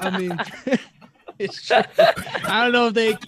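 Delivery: high, laughing reply. Real, not acted. Podcast guest reacting to a friend's bleak joke, laughing. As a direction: crack up while trying to answer.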